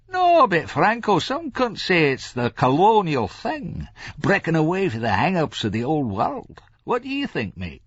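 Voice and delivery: high-pitched, mischievous Scots drinker; Larry. A narrator's reading. Larry puts a theory to Franco, teasing.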